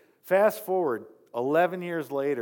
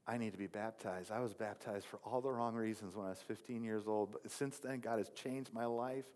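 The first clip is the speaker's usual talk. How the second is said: much softer, earnest and tender; quiet sincere confession; 2 semitones lower, 6 dB quieter than usual. Retold or imitated. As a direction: confess it softly and honestly. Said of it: "And I'm like".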